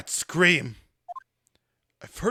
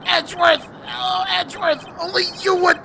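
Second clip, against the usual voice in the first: high, loud bellow; enraged villain; 10 semitones higher, 5 dB louder than usual. Acted. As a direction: rage at the one who defied you. Villain rages at Edgeworth.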